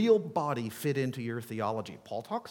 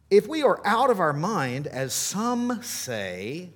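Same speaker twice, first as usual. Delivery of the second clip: louder, mocking the accusers; sarcastic; 5 semitones higher, 7 dB louder than usual. Shown as a demonstration. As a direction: drip with sarcasm on as some say. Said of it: "can't you hear just a skosh of sarcasm there".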